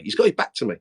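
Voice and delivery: normal talking voice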